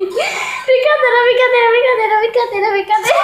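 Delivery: laughing loudly through the words; half-shouting while cracking up. Real, not acted. Caught joking, bursts out laughing.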